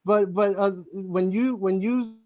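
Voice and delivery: tripping over the words with false starts; halting, repeated starts, searching for the word; stuttering. Real, not acted. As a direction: stammer and restart the phrase until it comes out. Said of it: "I'm sorry, I'm stuttering a little bit".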